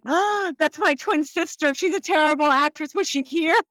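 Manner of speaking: feigned innocence. Pretend. Pretending her twin is someone else.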